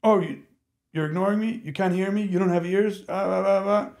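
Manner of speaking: high, insistent mock child's nagging; high-pitched impression of a demanding child; imitating pestering kid. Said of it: "what he's going through is like".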